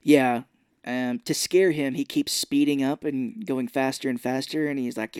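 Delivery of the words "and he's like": ordinary delivery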